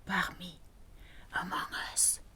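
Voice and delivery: very soft whisper; secretive whisper. Shown as a demonstration. Teacher illustrating "among us", secretive whisper. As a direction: breathe it out as a hushed secret.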